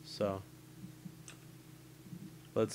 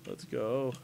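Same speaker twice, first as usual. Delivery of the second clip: ordinary talk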